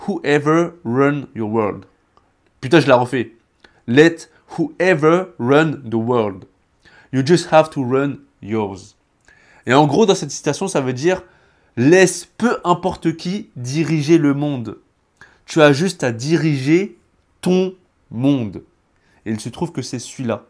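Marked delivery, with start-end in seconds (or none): 0.1-8.9 slow, emphatic French-accented English; deliberate English line, French accent. Shown as a demonstration. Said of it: "mon accent anglais". 11.8-18.6 slow, weighty declaiming; raised, deliberate quotation voice; emphatic translated quote. Shown as a demonstration.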